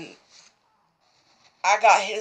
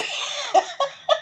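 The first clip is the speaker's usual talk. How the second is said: very high giggle; laughing at the sick husband; high squealing laugh; 4 dB quieter than usual. Real, not acted. Laughing about husband calling in.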